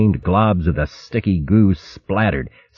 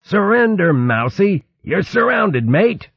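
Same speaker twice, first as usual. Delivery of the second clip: hint of a gruff river otter; high bluff challenge; 13 semitones higher than usual. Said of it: "A gruff voice responded".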